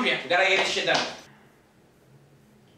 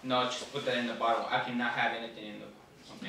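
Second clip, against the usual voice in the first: low, lifeless clerk voice; numb, bored shop clerk; dead voice; 13 semitones lower, 10 dB quieter, faster than usual. Acted. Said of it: "my dead voice".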